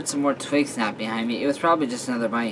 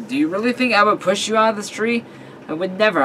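higher, incredulous questioning; mock-offended protest; 8 semitones higher than usual. Reassuring best friend in the tree, incredulous protest. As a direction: protest it with rising disbelief.

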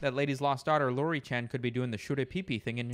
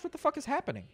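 much higher, stunned "what the fuck"; baffled disbelief; 12 semitones higher, much faster than usual. Real, not acted. Streamer watching the brainwashed girl chant, baffled and much higher. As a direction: react in total disbelief.

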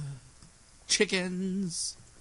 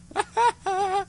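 high giggle. Real, not acted. Laughing fit.

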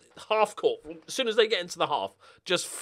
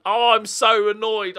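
annoyed; 5 semitones higher, 11 dB louder than usual. Real, not acted. After conceding the equaliser.

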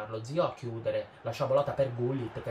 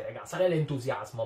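ordinary talk

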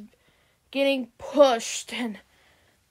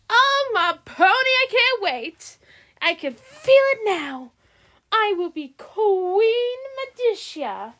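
gleeful villain; 10 semitones higher, 6 dB louder than usual. Acted. Villain thrilled about takeover.

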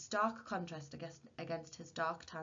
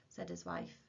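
ordinary delivery, nothing marked

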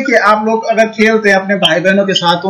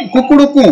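rooster call; 5 semitones higher than usual. Shown as a demonstration. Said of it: "कुकुट वदति".

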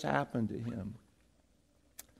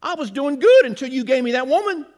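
Adam blaming God; 6 semitones higher, 6 dB louder than usual. Retold or imitated. Preacher voices Adam whining to God about the woman, higher and louder. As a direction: sound wronged and put out. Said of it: "He's saying".

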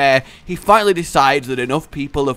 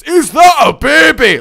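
mock Fat Bastard shout; 11 semitones higher, 9 dB louder than usual. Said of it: "go full fat bastard".

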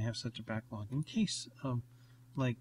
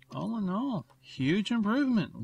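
much higher, bright and pleased; excited; 8 semitones higher, 4 dB louder than usual. Real, not acted. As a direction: cheer the win. Celebrating key and recording.

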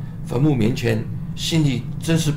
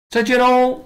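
higher, louder sing-song farewell; cheerful goodbye; 11 semitones higher, 5 dB louder than usual. Real, not acted.

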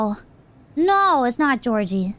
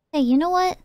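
normal talking voice

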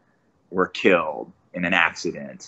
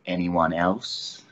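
very slow, quiet threat; villain turning to the other hostages; menacing villain; 3 semitones lower, 5 dB quieter, much slower than usual. Retold or imitated. Quiet villain threat, very slow. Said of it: "And then I look and I say".

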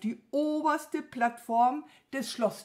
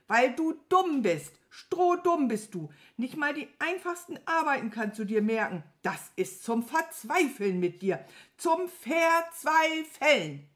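higher, louder scolding, slowing at the end; angry ranting; 6 semitones higher, 4 dB louder than usual. A narrator's reading. Wizard despairing of his stupid servant, angry ranting. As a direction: rant in despair, stretching the last word. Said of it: "Zornig stampfte der große Zauberer".

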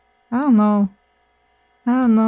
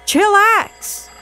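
high, sassy mock retort; sassy comeback; 6 semitones higher, slower than usual. Clerk retorting to a rude customer, sassy high mock voice. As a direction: snap back dismissively in a high voice. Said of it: "And I'm like".

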